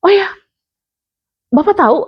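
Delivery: high, laughing amazement; delighted surprise. Retold or imitated. Mother amazed the daycare man knows, high laughing surprise. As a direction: sound like you just won the lottery. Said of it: "Terus aku kayak".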